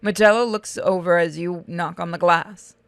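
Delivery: ordinary talk, nothing marked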